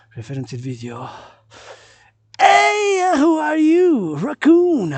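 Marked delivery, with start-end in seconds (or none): 2.4-4.9 high, loud excited call to an animal; hey, a raccoon up there. Real, not acted. Calling out to a raccoon.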